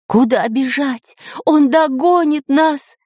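high, slow, despairing cry; Пыхтелкин crying out. A narrator's reading. Professor sees no escape, crying out. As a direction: panicky wail, words drawn out. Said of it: "кричал профессор Пыхтелкин".

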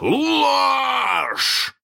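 long, high furious shout; one word stretched into a roar; hoarse roar. A narrator's reading. Hook roars at the impostor, hoarse shout. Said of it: "хрипко взревел Крюк".

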